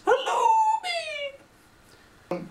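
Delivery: very high, loud squeak; squeaky hello. Acted. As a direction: squeal a silly greeting. Silly high greeting.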